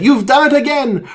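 high, loud storybook cry; delighted crowd exclamation. Acted. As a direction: cheer the hero's name.

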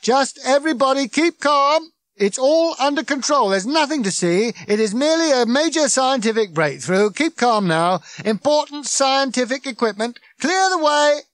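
high, shouted crowd-control patter; megaphone-style public announcement; booming. A narrator's reading. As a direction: bellow officious reassurance to a crowd. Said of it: "boomed Ford".

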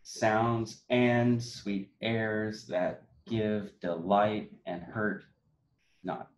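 very slow, five beats spelled out; iambic reading. Shown as a demonstration. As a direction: stretch each iamb, slowly. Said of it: "ba-bump five times".

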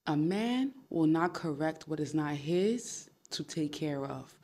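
slow, weighty, every word spelt out; slow emphatic. Shown as a demonstration. To her viewers, repeating the key line: slow and weighty. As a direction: lay each word down slowly so it sinks in. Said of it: "open your ears and listen".

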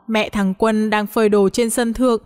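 plain reading voice

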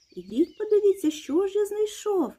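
excited high call to come and look; proud storybook rooster call. A narrator's reading. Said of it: "та й погукав мишенят".

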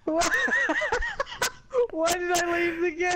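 high, loud yell cracking with laughter; laughing shout. Real, not acted. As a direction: shout it while crying with laughter. Laughing argument about leaving.

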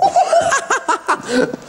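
high burst of laughter; giggling high laugh; ticklish laugh. Real, not acted. [name] laughs at being tickled.

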